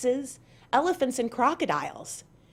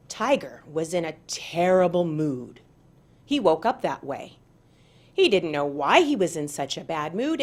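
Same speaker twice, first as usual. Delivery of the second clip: story narration; 3 semitones lower than usual. A narrator's reading. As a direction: read it like a tale for children.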